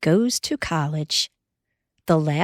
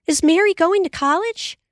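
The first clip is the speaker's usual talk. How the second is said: much higher, a touch louder, bursting out; eager cry; 12 semitones higher, 3 dB louder than usual. A narrator's reading. Laura crying out to Pa at the table, excited and high. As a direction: blurt it out in excited hope. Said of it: "Laura cried out".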